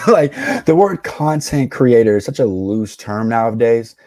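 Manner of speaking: usual speaking voice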